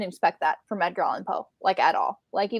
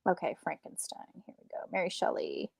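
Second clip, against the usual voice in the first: whispered, much softer reading to herself; soft whisper while looking it up; reading under breath; 13 dB quieter than usual. Real, not acted. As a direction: mutter it while scanning the page. Reading search results to herself.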